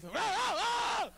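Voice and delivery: high, loud shriek of dread; hammed-up terrified oh oh oh; horrified shriek. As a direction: scream at the memory of the ex-wife. He thought of the ex-wife.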